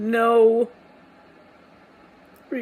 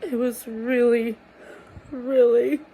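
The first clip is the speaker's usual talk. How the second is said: ordinary talk, nothing marked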